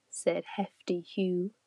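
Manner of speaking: plain reading voice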